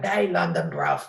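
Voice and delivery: a deep, mock-tough "That ain't London, bruv"; mate's mocking London voice. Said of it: "sends me voice messages going".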